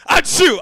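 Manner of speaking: high loud fake sneeze. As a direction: blast out a big sneeze. Second imitated sneeze.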